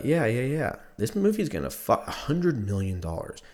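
ordinary talk, nothing marked

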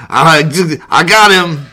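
loud, high triumphant yell; triumphant shout. Retold or imitated. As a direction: shout it like you won. Acted comic moment.

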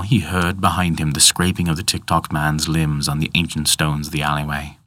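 plain reading voice

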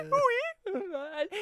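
high-pitched hooting call; mock calling out. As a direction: imitate a silly sing-out call. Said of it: "He says".

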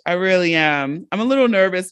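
ordinary talk, nothing marked

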